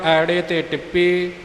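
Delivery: ordinary talk, nothing marked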